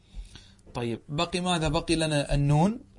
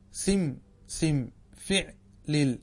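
slow spelled-out pattern; much slower than usual. Shown as a demonstration. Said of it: "أين فاؤه؟".

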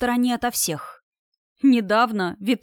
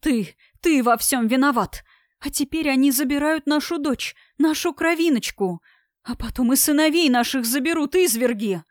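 wailing lament; 4 semitones higher than usual. Acted. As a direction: wail it across the square. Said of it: "причитала мама на всю площадь". (причитая на всю площадь)

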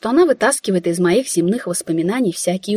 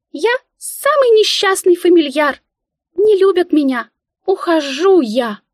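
high loud squeaky theatrical lament, slowing at the end; tragic squeal; 10 semitones higher, 4 dB louder than usual. A narrator's reading. Mika leaving in a huff: hammed-up tragic squeal. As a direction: ham it up like a tragic actress mouse. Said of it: "полным трагизма голосом".

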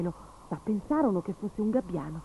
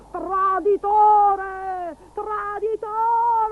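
high, loud, very slow drawn-out screech; furious scream; 13 semitones higher, 7 dB louder, much slower than usual. Acted. The sea witch screaming at Gobbolino as she vanishes, furious. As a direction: howl it as the wind drags her off. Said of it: "gridò".

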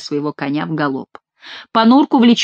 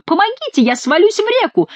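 high, frightened cry; crying for help; 13 semitones higher than usual. A narrator's reading. Monk fears falling into the river, crying out. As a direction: wail in alarm, pitch way up. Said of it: "кричал монах".

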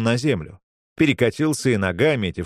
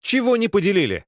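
stern demand; 11 semitones higher than usual. A narrator's reading. Man questions the brawling boys.

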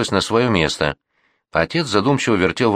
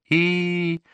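high, soft held whine; long thin whimper on one note; whimpering wail; 11 semitones higher, 4 dB quieter than usual. A narrator's reading. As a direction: whine like a distressed old woman. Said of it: "вновь заскулила баба Настя".

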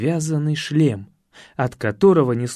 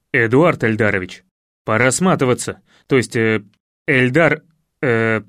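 slightly muddled low voice urging a donkey; уговаривающий всадник; 4 semitones lower, 4 dB louder than usual. Acted. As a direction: address it low, stumbling over the name. Рассказчик уговаривает осла, путая имя. (низко, с лёгкой запинкой)

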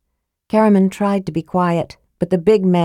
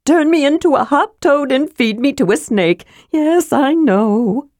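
resigned sigh; 9 semitones higher than usual. A narrator's reading. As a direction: recite the old threat in a high, sing-song sigh. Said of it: "Tass sighed".

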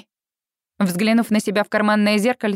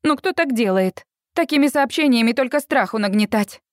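ordinary delivery

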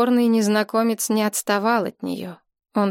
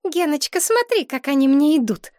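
put-on high, squeaky show-off girlfriend; girlish squeak; 8 semitones higher, 4 dB louder than usual. A narrator's reading. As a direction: squeal it to your boyfriend, pitched way up. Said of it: "пропищала обесцвеченная девица". (писклявый девичий голос)

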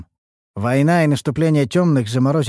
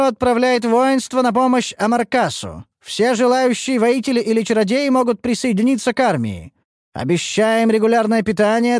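town crier; 12 semitones higher than usual. Acted. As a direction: cry it out to the crowd. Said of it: "зычный голос глашатая". (зычный голос глашатая)

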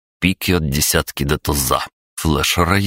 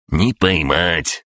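slow, cool brush-off; understated imperturbable creature; unruffled retort; 2 semitones higher, much slower than usual. A narrator's reading. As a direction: brush it off slowly, untroubled. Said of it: "невозмутимо отбрил крабогном".

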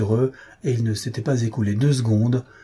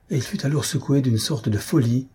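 ordinary delivery

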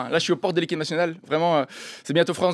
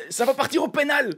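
high, indignant legal threat; hammed-up high-pitched outrage; mock furious threat; 7 semitones higher than usual. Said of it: "et là, le mec fait".

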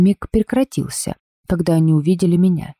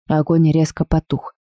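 ordinary delivery, nothing marked

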